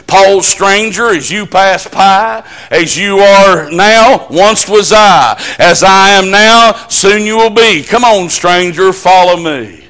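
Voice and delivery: slow, weighty reading of an epitaph; slow solemn recital. Acted. Preacher reciting a gravestone to the church, slow and solemn. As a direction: let every line land slowly.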